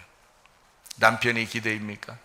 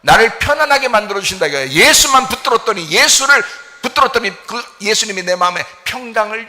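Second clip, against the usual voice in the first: loud, high, driving sermon voice; fervent preaching; 8 semitones higher, 8 dB louder than usual. Acted. Pastor urging the congregation, fervent loud preaching. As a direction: preach it out with full conviction.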